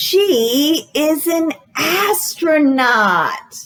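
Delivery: bright, sing-song storytime read; reading to children. Acted. Librarian reading a guessing book to children, sing-song. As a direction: read it like a picture book to little kids.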